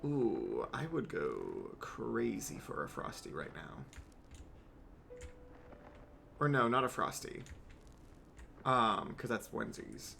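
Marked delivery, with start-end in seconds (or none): 0.0-5.2 slow, soft, lower longing; hushed yearning for ice cream; low dreamy craving. Real, not acted. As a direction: murmur it like you can taste it. Craving a Dairy Queen frosty.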